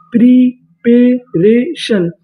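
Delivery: Preparation stretched out slowly, syllable by syllable; slow drawn-out word. Shown as a demonstration. Teacher to students, drawing preparation out slowly. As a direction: draw out preparation slowly for the class. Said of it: "तो meaning बनी रे".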